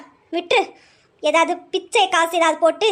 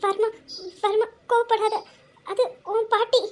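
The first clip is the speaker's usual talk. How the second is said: frightened pleading; 4 semitones higher, 4 dB quieter than usual. Acted. Wife pleads with angry husband.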